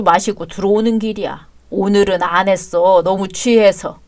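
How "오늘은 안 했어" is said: ordinary talk, nothing marked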